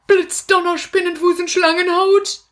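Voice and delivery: high, dramatic spell chant; wizard's incantation. Acted. Puppet wizard casting a spell for the children, high and dramatic.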